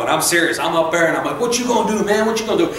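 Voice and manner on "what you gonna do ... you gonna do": higher-pitched mock tough-guy taunt; pitched-up, cocky teenage challenge, retold; mouthy challenge. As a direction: act out squaring up for a fight. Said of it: "my mouth got me in some trouble".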